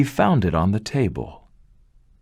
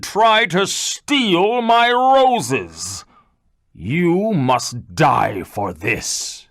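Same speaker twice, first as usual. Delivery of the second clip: loom over him and pronounce the sentence; angry beast; 6 semitones higher, slower than usual. Acted. Beast accusing the man of stealing its roses, slow menacing fury.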